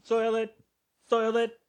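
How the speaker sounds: very high, put-on squeak; high catchphrase voice. Teasing the other host with a repeated line, high put-on voice. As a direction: chant it identically in a high mock voice.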